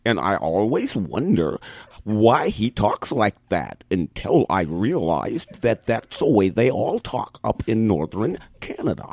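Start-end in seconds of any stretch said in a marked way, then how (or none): 2.0-9.1 put-on Canadian accent. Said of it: "talks like this all the time".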